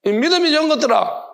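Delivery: high-pitched scolding of the faithless; acted divine reprimand; stern rebuke. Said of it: "혼나야 됩니다".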